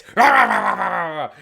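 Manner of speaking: loud, high imitated snarl, falling; sudden loud burst of mock dog growling; exploding dog snarl. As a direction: erupt like a small dog going off. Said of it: "when you're about to put him down, it's".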